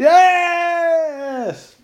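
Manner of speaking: long, high, loud cheer; drawn-out jubilant yell; triumphant shout. Real, not acted. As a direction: celebrate a correct guess at full voice. Triumph when accent is guessed.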